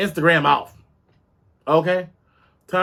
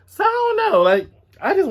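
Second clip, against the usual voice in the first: high squeak. Real, not acted.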